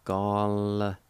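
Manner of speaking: drawn-out, separated syllables. Shown as a demonstration. Teacher showing the first part of janitor, syllable by syllable. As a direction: sound out each syllable for learners. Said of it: "you can pronounce the L sound ... like this".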